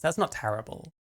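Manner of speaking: normal talking voice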